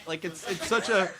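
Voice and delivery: ordinary talk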